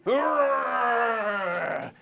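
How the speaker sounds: long falling mock scream; flying-through-air yell. Shrek sent flying by the dragon, long falling yell. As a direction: yell high, sliding down as it fades.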